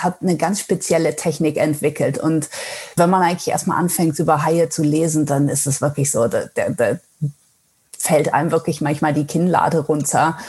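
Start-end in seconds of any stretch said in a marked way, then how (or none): none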